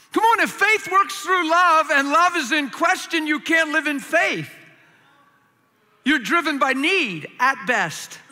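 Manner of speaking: loud, rising, fiery preaching; preaching at full force. Acted. Preacher pressing the congregation, fiery and loud. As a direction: drive the point home to the crowd.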